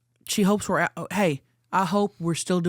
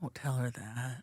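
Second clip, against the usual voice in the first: hushed scolding; 6 semitones lower, 12 dB quieter than usual. Real, not acted. Scolding aside.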